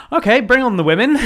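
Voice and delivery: eager captured pilot. Retold or imitated. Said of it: "first thing is".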